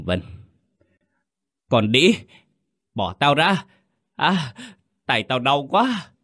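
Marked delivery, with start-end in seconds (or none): 1.7-6.1 pained yell. A narrator's reading. Quy yells at Vân to let go of his hand, screeching. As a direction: howl like he's being bitten to the bone. Quy bitten, yelling in pain.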